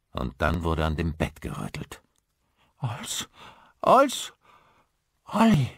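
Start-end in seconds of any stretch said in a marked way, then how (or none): 2.8-5.7 high, loud calling of his name; urgent call. A narrator's reading. Caro calls Olli out of his nightmare, loud. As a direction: wake him up, urgently.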